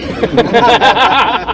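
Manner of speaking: high, loud, open laugh; burst of laughter. Real, not acted. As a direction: let a big laugh burst out. Laughing at the dubbing story.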